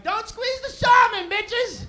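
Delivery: high, loud, slow cartoon shopkeeper; hammed-up Whipple shouting at shoppers; Mister Whipple. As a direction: belt it out like a crazed store clerk. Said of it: "he'd be like".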